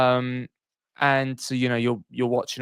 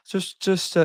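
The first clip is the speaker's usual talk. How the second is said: lightly mocking higher voice; imitating Andy; 8 semitones higher than usual. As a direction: tease a friend with a mock impression. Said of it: "this is my impression of you".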